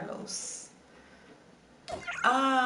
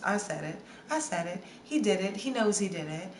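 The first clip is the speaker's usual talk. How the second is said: ordinary delivery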